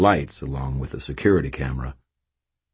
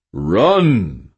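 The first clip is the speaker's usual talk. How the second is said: resonant baritone; 5 semitones higher, 6 dB louder than usual. A narrator's reading. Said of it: "said a resonant baritone".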